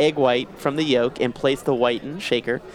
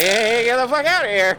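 rattlesnake voice; 6 semitones higher, 4 dB louder than usual. Retold or imitated. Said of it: "Rattlesnake is like".

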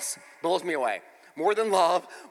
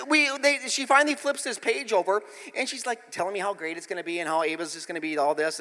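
usual speaking voice

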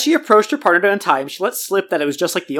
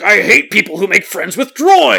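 slow, loud petty villain rant; mock villain; 2 semitones higher, 6 dB louder, slower than usual. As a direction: sneer it like a cartoon bad guy. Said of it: "he's like".

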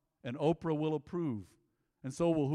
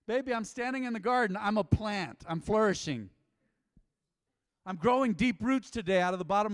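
mock proud husband; 3 semitones higher, 5 dB louder than usual. Pretending to tell wife he's a car.

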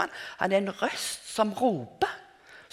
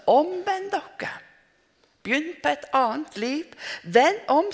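forkynnende rop; 8 semitones higher, 4 dB louder than usual. Retold or imitated. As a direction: cry it out like the prophet in the desert. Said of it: "sier han". (høyt og langsomt bøterop fra Johannes)

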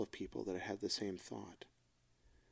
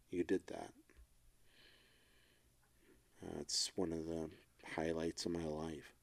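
low, soft and tender; quiet, halting sincerity, voice sinking low; heartfelt; 3 semitones lower, 5 dB quieter than usual. Real, not acted. Husband thanks partner for bringing hope back, heartfelt.

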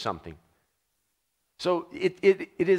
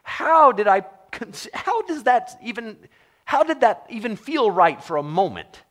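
baffled self-reproach; 7 semitones higher than usual. Retold or imitated. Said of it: "I look back and go".

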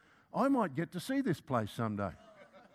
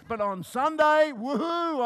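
much higher and louder, slow whoop; gleeful whoop; 7 semitones higher, 7 dB louder, slower than usual. Pretend. Picturing his Sunday school triumph, whooping gleefully. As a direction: cheer it like a secret win.

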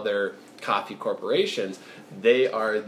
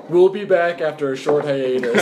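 announcer; 4 semitones higher, 7 dB louder than usual. Pretend. Mock show break.

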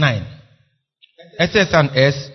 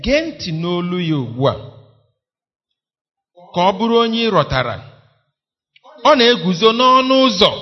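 hymn recital; 4 dB louder, faster than usual. Acted. Stanza three.

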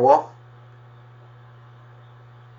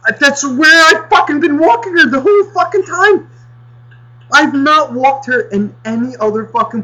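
much higher, louder outraged rant; high-pitched indignant shout; retold furious yell; 13 semitones higher, 6 dB louder than usual. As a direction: explode at the neighbor who should have warned you. Furious retort to the neighbor.

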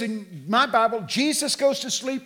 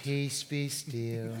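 soft, slow, low recital; mock-poetic hush, drawn out; quoting poetry; 7 semitones lower, 10 dB quieter, much slower than usual. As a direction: murmur it serenely like verse. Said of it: "like he was quoting poetry".

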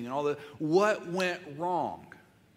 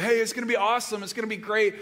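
mock excitement; 7 semitones higher, 5 dB louder, faster than usual. Voicing Adam and Eve's hopes.

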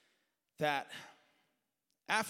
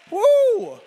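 high, loud whoop of praise; a real burst of joy; joyful whoop; 18 dB louder than usual. Real, not acted. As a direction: let out a big church whoop. Preacher's shout of joy.

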